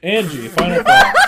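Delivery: high laughing handover. Real, not acted. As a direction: say it while cracking up. Host laughing while passing turn.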